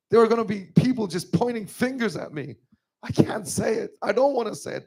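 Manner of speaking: crying while telling it, pitch high and strained; choked up, high and tearful; tearful, voice breaking. Real, not acted. Retelling Mother's plea to the audience, crying. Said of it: "And I was crying".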